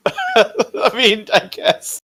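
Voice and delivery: high, laughing concession; amused, high-pitched reluctant agreement. Real, not acted. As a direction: give in through a laugh. Amused reply to player's odd question.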